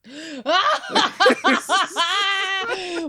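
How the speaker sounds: big, high cackle of real laughter; loud burst of laughter. Real, not acted. Co-host cracks up at the misheard words, loud high laughter. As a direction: crack up loudly.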